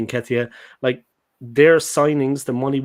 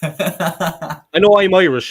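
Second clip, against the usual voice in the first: mock proud; 5 semitones higher, 8 dB louder, much slower than usual. Real, not acted. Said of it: "I know I sound like a potato".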